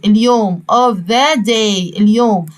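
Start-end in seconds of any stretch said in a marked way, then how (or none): 0.7-2.0 of the day again very slowly, stress on the; slow model phrase. Shown as a demonstration. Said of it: "في نهاية اليوم". (of the day ببطء)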